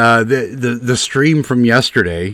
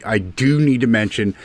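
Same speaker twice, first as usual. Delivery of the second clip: ordinary delivery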